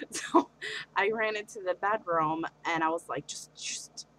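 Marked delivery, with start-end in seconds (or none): 3.3-4.1 breathy whisper to herself; whispered self-soothing. Retold or imitated. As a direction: hush yourself, barely voiced. Calming herself after breaking down.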